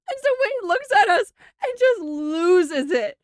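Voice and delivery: high, teary laughter in the voice; trying to talk through a fit of giggles; real crying-laughing; laughing through words. Real, not acted. Laughing while telling funny story.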